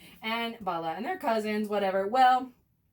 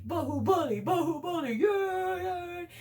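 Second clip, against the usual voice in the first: higher, chant-like cheering; mock crowd cheer; 10 semitones higher than usual. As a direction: cheer him like the whole kingdom. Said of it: "the whole of the kingdom's like".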